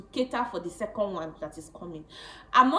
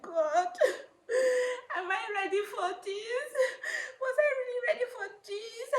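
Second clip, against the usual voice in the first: high tearful crying voice. Retold or imitated. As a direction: sob the words out, high pitched. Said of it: "I said, I started crying".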